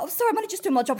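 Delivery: high, pleading apology; flustered apology. Retold or imitated. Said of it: "I was like".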